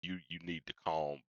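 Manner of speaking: low, soft mock warning to calm down; hushed scolding.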